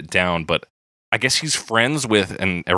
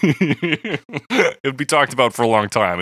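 laughing; 6 semitones higher, slower than usual. Real, not acted. Laughing while speaking.

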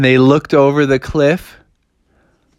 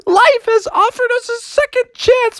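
high overjoyed cub voices. Acted. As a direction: squeal with hammed-up joy. Bears overjoyed at second chance.

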